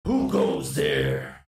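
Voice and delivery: very low, slow menacing voice; deep robot challenge. Acted. Sentry robot challenging strangers in the dark, low and slow. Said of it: "I go".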